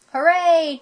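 high, happy hooray; cheer. A narrator's reading. Splat reaching the penguin pool, cheering. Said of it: "cheered Splat".

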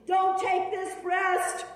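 high, loud, slow cry; anguished cry. Acted. Patient begging the surgeon, high, loud, slow. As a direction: plead against the blade.

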